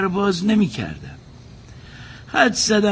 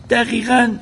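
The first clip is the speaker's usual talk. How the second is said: surprised; 6 semitones higher, 6 dB louder than usual. Acted. Servant answers the angry guest.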